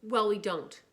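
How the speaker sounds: put-on embarrassed kid snapping at his friend. A narrator's reading. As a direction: bite the words off, defensive. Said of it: "I snapped".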